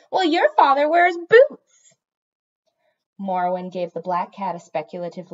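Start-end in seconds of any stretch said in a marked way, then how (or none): 0.1-1.9 loud, high, drawn-out insult; taunting comeback. A narrator's reading. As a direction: hurl it back as a cat's taunt. Cat hurls an insult back.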